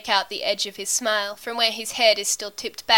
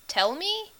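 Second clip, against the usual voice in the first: high, soft rising question; puzzled; 9 semitones higher, 5 dB quieter than usual. A narrator's reading. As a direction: echo it quietly, lost. Confused character echoes the words.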